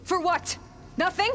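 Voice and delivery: loud, high, bitter shouted questions; angry outburst. Acted. Aloy rails at Sylens after failure, angry shout. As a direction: hurl the questions out in despair. Despair after useless backup.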